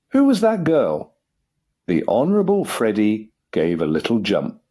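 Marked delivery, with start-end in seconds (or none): 0.1-1.2 high, insistent questioning; stern demand. A narrator's reading. Father interrogating his son about a girl, high and demanding. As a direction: demand an answer, pitch jumping up. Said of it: "he demanded, frowning deeply".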